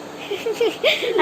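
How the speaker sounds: a burst of delighted laughter; high giggle. Real, not acted. Mother laughing at her shy daughter, high giggle.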